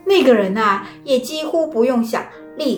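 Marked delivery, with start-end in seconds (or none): none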